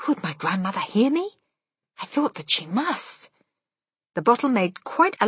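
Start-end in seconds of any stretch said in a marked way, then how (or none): none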